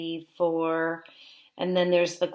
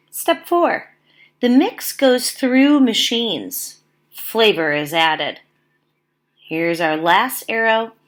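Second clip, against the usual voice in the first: louder, lively read-aloud of numbered steps; reading steps to children; 4 dB louder, slower than usual. Acted. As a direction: count off each step for the class.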